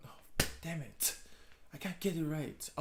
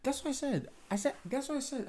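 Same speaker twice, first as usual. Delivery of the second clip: much higher, delighted; excited pride; 13 semitones higher, faster than usual. Real, not acted. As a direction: squeal with pride at getting it right. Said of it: "proud of getting it".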